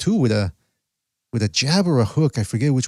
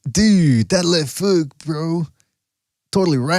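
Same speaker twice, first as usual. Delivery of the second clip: surfer dude voice; 4 semitones higher, slower than usual. Acted. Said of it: "sound like a surfer".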